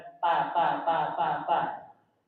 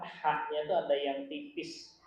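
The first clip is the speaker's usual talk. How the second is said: ordinary delivery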